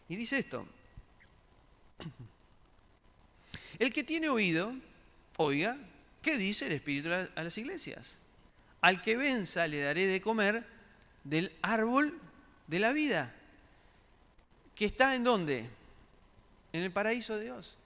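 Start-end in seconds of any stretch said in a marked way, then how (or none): 3.8-13.3 loud, higher proclaiming of the verse; scripture proclamation. Acted. Preacher proclaiming Revelation to the congregation, loud and high. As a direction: call the verse out to the whole church.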